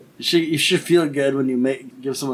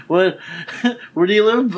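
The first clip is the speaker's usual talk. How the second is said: confused question; 6 semitones higher, 3 dB louder than usual. Retold or imitated. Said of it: "They'll be like".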